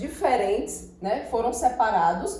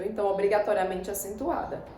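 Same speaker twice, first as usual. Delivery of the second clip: ordinary delivery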